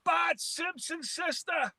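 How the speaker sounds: high, drawn-out mock line call; slow singsong impression of a Boston teammate; imitated teammate's call. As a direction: imitate a lineman calling out, stretched and high. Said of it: "Was that your Pete Kendall invitation".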